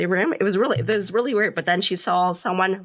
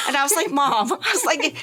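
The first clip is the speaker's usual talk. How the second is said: higher, laughing disbelief; 8 semitones higher than usual. Retold or imitated. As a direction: laugh through mock exasperation. Retelling own exasperated reply.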